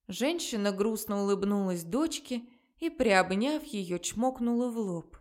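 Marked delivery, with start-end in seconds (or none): none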